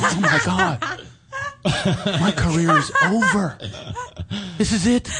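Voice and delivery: high, far-away whimper of doom; hammed-up dawning horror; mock despair. Actor realizing the whole room heard, mock despairing whimper. Retelling his panic at failed audition.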